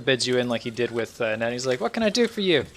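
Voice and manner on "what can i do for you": high-pitched elf shopkeeper voice; young elven merchant. Acted. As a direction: pitch it up, serving a customer. Elven merchant addresses a customer.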